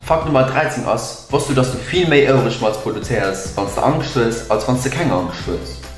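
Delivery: usual speaking voice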